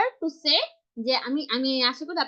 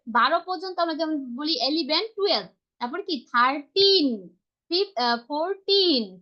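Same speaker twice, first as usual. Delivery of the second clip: ordinary talk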